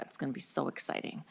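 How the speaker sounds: dry, deadpan sarcasm; sarcastic. Real, not acted. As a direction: say it with mock enthusiasm. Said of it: "And I mean that sarcastically".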